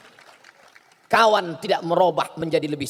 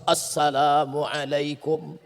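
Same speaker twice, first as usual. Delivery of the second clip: very slow, low, drawn-out formal preacher's greeting; stately pulpit salam, much slower and lower; Zainuddin's formal salam; 5 semitones lower, much slower than usual. Retold or imitated. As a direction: stretch every syllable like a grand old-school preacher. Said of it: "Dari mulai salam, ... lihatlah bagaimana Zainuddin".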